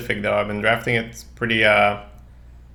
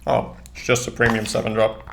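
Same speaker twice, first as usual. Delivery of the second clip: ordinary talk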